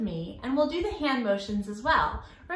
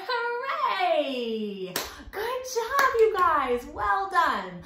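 excited cheer; 7 semitones higher, much slower than usual. Real, not acted. Cheering the kids after song.

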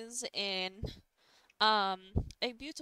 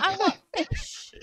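put-on childish demand; 9 semitones higher, 9 dB louder than usual. GM voicing childlike Siegfried.

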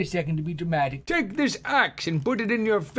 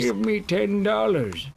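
ordinary talk, nothing marked

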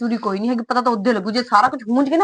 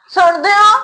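higher and louder call across the house; 6 semitones higher, 5 dB louder, slower than usual. Acted. Wife calling her husband from another room, loud call. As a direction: call him to come over.

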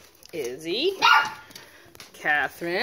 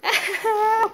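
high sharp yelp of pain; 7 dB louder than usual. Real, not acted. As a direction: yelp as teeth catch. Puppy bites her toe.

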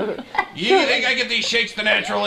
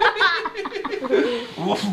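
giggling laugh; 5 semitones higher, 4 dB quieter than usual. Real, not acted. After bounce.

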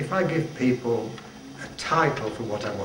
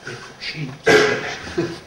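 put-on slow, drawn-out French accent on cheeps; mock French accent; 6 dB louder, much slower than usual. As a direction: ham up the Frenchman saying cheeps. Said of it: "with a beautiful accent".

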